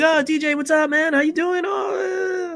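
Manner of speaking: high, excited shout of welcome; delighted greeting. Retold or imitated. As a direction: greet an old friend with glee. Omar greets him warmly.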